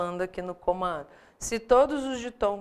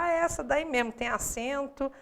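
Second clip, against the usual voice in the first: imitating an over-eager student, pitched up; empolgado; 6 semitones higher than usual. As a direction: jump at the answer in a higher, eager voice. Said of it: "tem gente que se emociona". (imitando aluno que se empolga, voz mais aguda)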